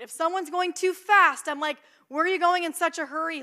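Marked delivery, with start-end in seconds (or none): none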